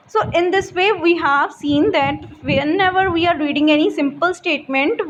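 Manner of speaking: ordinary talk, nothing marked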